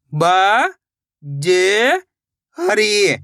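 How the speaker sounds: ब, ज, हरी, each drawn out on its own; syllable-by-syllable sounding out. Shown as a demonstration. Teacher shows learners how to read, ब, ज, हरी sounded one by one. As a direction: stretch ब and ज separately, then हरी. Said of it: "एक एक अक्षर का उच्चारण करके".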